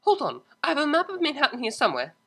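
rushing while she looks; hurried Octavia. Acted. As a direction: cellist hurrying to find a map, fast. Octavia hurrying.

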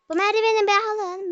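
pushy yell for help with the ladder; loud plea. Real, not acted. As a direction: shout it up to get their attention. Begging teammates to grab the ladder.

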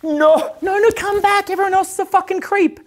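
hammed-up pleading girls begging him back; mock girls pleading. Girls begging the guy to come back, in a high mock voice. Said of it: "the girls are gonna be like".

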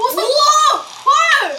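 loud, high amazed yelp; amazed shout. Real, not acted. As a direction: burst out in amazement. Amazed at game event. (깜짝 놀란 외침)

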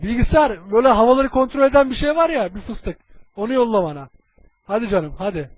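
normal talking voice

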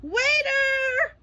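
very high, loud rising call; calling the waiter. A narrator's reading. SpongeBob calling the waiter, very high loud call. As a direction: call across the room. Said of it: "SpongeBob called out".

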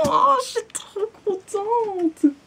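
high, slow delighted squeal; thrilled squeal. Real, not acted. Ravie en déballant. (voix aiguë ravie)

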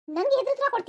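high-pitched, affronted question; outraged challenge. Acted. Mother-in-law challenges daughter-in-law for answering back, outraged. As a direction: pounce on the backtalk, pitch jumping up.

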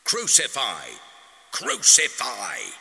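loud, high mob chant; fierce crowd shout. A narrator's reading. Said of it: "they shouted".

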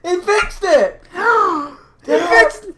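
high, loud elated yell; excited shouting. Real, not acted. Friend celebrating the fixed echo, excited shouting. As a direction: shout it high with a gasp between.

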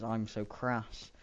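slow, drawn-out punchline; slow deadpan rhyme. Retold or imitated. Finishing a made-up rhyme, said slow and deadpan. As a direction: let each word sit slowly. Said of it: "came into my head was".

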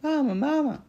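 put-on high, loud baby-bird cry for mama; little gosling voice, pitched high; peeping gosling. A narrator's reading. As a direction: peep it like a needy hatchling. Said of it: "peeped the gosling".

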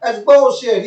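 high, mild mock yell; imitating yelling coach. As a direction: protest like a coach at the referee. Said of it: "He's yelling at the referee".

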